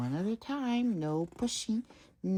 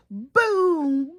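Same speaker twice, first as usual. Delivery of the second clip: loud high boom; booming drum; 10 semitones higher, 9 dB louder than usual. Acted. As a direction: hit it like a bass drum.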